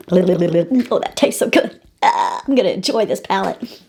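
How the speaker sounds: talking with chocolate in mouth; eating chocolate. Real, not acted. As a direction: speak around a mouthful of chocolate. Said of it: "I've got chocolate in my mouth".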